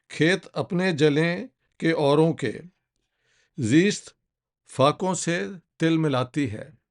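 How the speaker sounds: slow, measured Urdu verse with pauses between phrases; poem recitation. Acted. Speaker reads Sahir's anti-war nazm to viewers, solemn recitation. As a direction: recite each line deliberately, letting it land. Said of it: "पढ़ के सुनाना चाहता हूँ".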